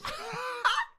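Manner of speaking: squeaky burst of laughter; shrill cackle breaking out; high giggle; high-pitched laugh. Real, not acted. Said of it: "just a high-pitch ass laugh".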